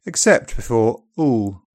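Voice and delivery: ordinary delivery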